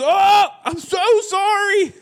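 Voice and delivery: high, slow, drawn-out mock apology; mocking whiny apology. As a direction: mimic the kid's pathetic sorry.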